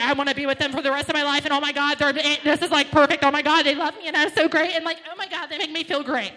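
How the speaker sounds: loud, giddy lovestruck rave; giddy gushing. Acted. Lovestruck dater raving about her new partner, loud and giddy. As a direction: gush like you're drunk on love. Dick drunk lover gushing.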